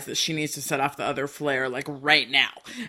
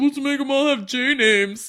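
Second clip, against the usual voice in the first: lightly voiced parents, pitched up; mock parents; 7 semitones higher than usual. Imagined parents picking their kids' names, mock enthusiasm. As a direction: put on a higher, chipper parent voice.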